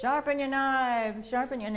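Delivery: put-on slow, loud street cry in a traveller's accent; loud traveller-accent street call, drawn out. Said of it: "in their accent".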